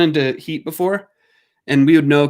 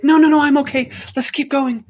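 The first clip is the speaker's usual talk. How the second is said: high, louder mock eager dog voice; voicing the dog; 10 semitones higher, 3 dB louder than usual. Little dog insisting she is fine, in a high mock voice. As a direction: make her squeaky and keen. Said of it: "but then she'd be like".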